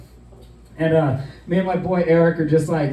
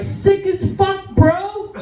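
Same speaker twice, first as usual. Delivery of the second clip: drawn-out, much higher hype yell; excited bro shout; much slower than usual. Retold or imitated. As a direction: yell it back like a stoked skier bro. Shouting back excitedly at ski patrol.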